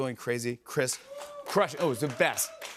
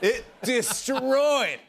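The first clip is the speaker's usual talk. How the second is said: excited; 10 semitones higher, 4 dB louder, much slower than usual. Real, not acted. Raving about Backstreet Boys.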